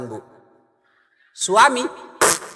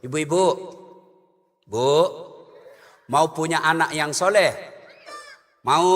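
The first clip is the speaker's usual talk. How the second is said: ordinary talk, nothing marked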